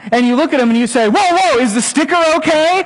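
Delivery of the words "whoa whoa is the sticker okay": higher, louder fussing over the sticker; silly parent. Retold or imitated. A parent checks the sticker on a bleeding kid, hammed-up. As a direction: play the clueless parent, hammed-up.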